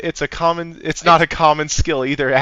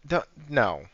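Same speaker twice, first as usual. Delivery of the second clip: ordinary delivery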